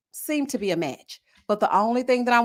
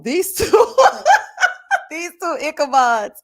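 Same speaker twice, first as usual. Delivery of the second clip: high laugh breaking through the words; 6 semitones higher, 5 dB louder than usual. Real, not acted. Mocking the silly couple, laughing.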